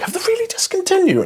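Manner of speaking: very high, fast squeak of disbelief; aghast, high-pitched question; mock disbelief. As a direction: squeal it like you can't believe your ears. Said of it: "I was like".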